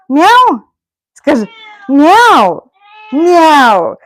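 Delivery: high, loud mock meow; imitating a meow. Owner meowing back to her cat, high and loud. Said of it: "and that's how we are talking".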